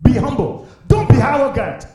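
ordinary talk, nothing marked

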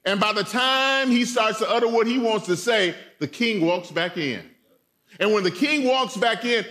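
ordinary delivery

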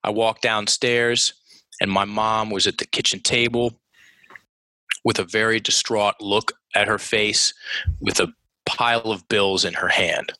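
measured, long breathing pauses at the periods; subdued, deliberate recounting; slowed-down storytelling. Shown as a demonstration. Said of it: "I'll give you an example".

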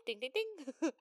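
high imitated notification ding; alert chime. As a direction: sing out a phone's alert sound. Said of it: "like, Google alert for her visions".

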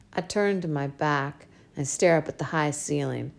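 plain reading voice

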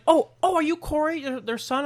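mock high-pitched excited surprise; surprised recognition. Hotel clerk mistaking a guest for Corey, mock surprise. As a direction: imitate someone suddenly recognizing a guest.

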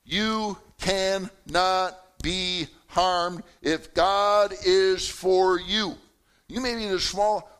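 loud, slow, drawn-out preaching with each phrase held; preaching at full force. Acted. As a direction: lift the room with fervour, slowly. Preacher opens with God's power.

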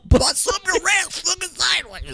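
high, strained mock rant on one breath; held-breath angry talk. Mocking the actor getting pissed, held-breath strained rant. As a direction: imitate a pissed-off actor holding his breath. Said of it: "like, he's holding his breath when he's talking".

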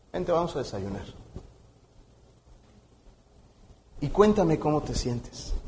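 soft, low, caring invitation; tender, hushed listening voice; gentle compassion. Acted. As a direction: invite the child to open up, quietly. Gracious adult comforts the boy.

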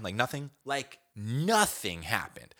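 usual speaking voice

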